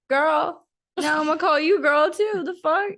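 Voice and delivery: put-on high, loud sassy girl; sassy comeback. Teasing Chris with a high, loud sassy comeback. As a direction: throw it back at him with attitude. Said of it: "I said".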